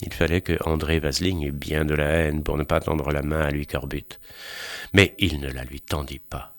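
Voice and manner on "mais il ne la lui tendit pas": usual speaking voice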